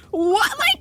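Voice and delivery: shocked. Retold or imitated. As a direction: squeak out your shock at him. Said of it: "but I'm also like".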